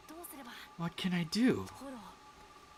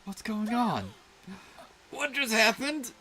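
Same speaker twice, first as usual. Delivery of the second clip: much higher, loud, amazed cries; astonished; 13 semitones higher, 5 dB louder than usual. Acted. Just escaped the darkness, crying out in amazement. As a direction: gasp out the disbelief.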